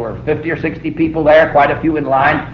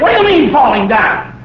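higher, mock-stern parent; mock scolding; 8 semitones higher, 4 dB louder than usual. Parent mock-scolding fallen baby, playful. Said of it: "You go and say".

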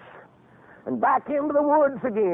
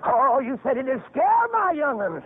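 ordinary talk

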